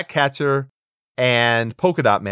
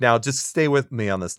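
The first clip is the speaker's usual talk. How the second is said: normal talking voice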